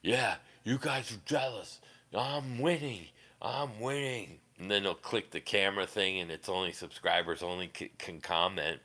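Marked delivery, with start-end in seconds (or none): 0.0-4.4 lightly voiced boastful streamer; mocking the streamer. Streamer answers his doubters about viewer counts, lightly mocking imitation. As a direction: imitate him insisting he's on top. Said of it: "I'm like, "How are you get".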